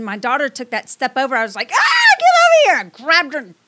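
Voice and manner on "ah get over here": sudden high panicked yell; panicked shout. Retold or imitated. Mother yelling at her daughter near the edge, panicked shout. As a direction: yank her back with a scream.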